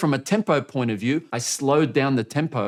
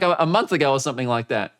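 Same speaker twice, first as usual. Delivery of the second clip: ordinary talk, nothing marked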